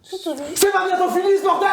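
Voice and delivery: put-on high furious shout at his kid; angry father yelling. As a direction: blow up at the kid like an enraged dad. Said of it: "c'est celle qui gueule".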